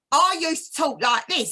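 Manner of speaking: thick East London speech from earlier years; East London accent. Shown as a demonstration. Showing how they used to talk, in an East London accent. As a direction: put on your old East London accent. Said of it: "used to have a real East London accent".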